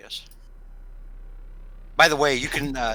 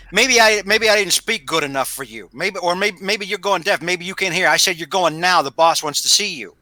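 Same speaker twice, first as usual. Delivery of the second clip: mildly raised, louder acted threat; irritated thug; 6 semitones higher, 5 dB louder than usual. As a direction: lean on him like an impatient enforcer. Thug Sal threatens a reluctant Jay.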